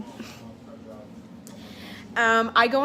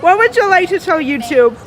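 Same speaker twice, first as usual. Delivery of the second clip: interviewer voice; 9 semitones higher, 12 dB louder, faster than usual. Acted. Playing interviewer to friend.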